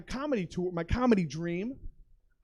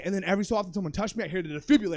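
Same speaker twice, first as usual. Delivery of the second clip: normal talking voice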